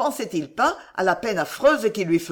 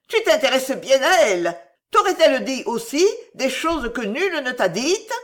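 high, raised jealous challenge; aggressive; 6 semitones higher, 4 dB louder than usual. A narrator's reading. Angered woman challenging him over her rival, aggressive. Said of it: "et elle gronda, agressive".

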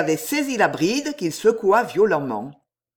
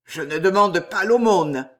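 plain reading voice

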